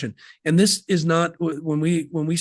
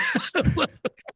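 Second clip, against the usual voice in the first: high burst of laughter. Real, not acted. Laughing at grandpa's mistake.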